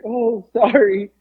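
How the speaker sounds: high, very slow mock apology; meek old lady. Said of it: "And she's like".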